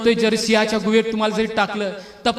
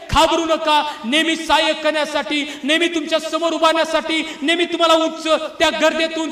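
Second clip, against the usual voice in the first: higher-pitched, insistent exhortation; impassioned preaching; 5 semitones higher than usual. Acted. Preacher pleading with the despairing not to give up, impassioned. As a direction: preach it urgently, driving each line home.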